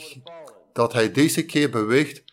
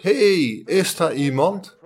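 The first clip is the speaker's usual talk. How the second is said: raised, higher shout for help; calling for help; 5 semitones higher, 4 dB louder, slower than usual. Acted. Man hanging from a cliff calls for help, raised shout. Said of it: "En hij schreeuwde".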